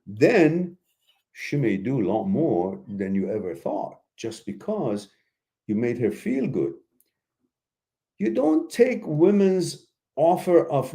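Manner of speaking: ordinary talk, nothing marked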